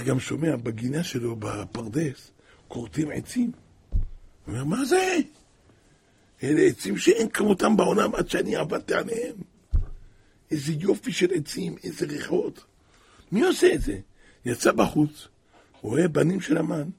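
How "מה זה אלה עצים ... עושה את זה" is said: a high, rising cry of amazement; the king finding his cut trees, calling out high; astonished king. Retold or imitated. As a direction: sound stunned and then outraged.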